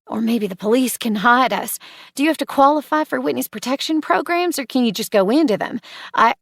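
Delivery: fast, rising panicky questions; spiralling, words tumbling out; anxious spiral. Acted. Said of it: "stop my spiral".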